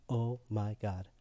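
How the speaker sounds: slow, hushed, low disbelief; stunned realisation. Retold or imitated. New convert realising what he said, stunned and hushed. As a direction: let it sink in, very softly.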